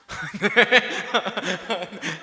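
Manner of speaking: loud amused burst of laughter. Real, not acted. Amused at own remark. (크게 터지는 웃음)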